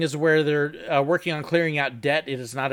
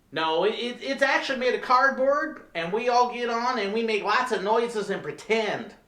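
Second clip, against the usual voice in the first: dumb answer; 6 semitones higher than usual. Acted. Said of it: "throw back really dumb answers".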